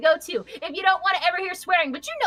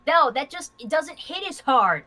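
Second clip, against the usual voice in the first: ordinary talk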